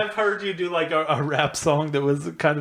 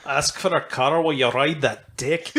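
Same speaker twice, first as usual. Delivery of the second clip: put-on shaky-grasp Scottish accent; badly faked Scottish lilt; attempted Scottish. Comedian reading rap lyrics, badly faked Scottish. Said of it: "some Scottish stuff".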